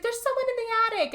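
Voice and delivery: high, frantic plea; panicked teen girl. Retold or imitated. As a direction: squeal it like a scared kid. Hannah voiced panicking to her parents.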